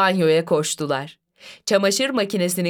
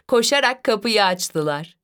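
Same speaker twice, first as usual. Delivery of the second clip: ordinary delivery, nothing marked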